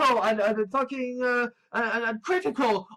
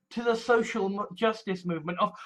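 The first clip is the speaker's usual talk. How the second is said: ordinary delivery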